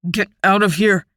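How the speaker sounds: speaking through gritted teeth in pain. A narrator's reading. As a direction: force it out through clenched teeth. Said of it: "he tells her through gritted teeth".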